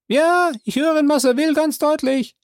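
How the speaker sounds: high, loud servant voice calling down; Jupiter's voice. Acted. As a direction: pitch him well up and call out. Jupiter answers.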